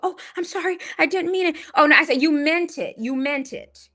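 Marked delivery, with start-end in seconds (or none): 0.0-1.6 very high-pitched put-on pleading; squeaky mocking "sorry" voice; mock apology. Usher voiced apologising when confronted. 2.2-3.9 raised, high-pitched accusation; re-enacted heated comeback, pitched up; retold angry retort.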